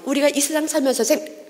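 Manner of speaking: ordinary talk, nothing marked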